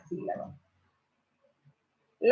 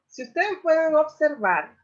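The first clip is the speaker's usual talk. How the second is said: ordinary delivery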